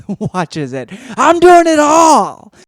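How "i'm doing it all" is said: mock shout. Real, not acted.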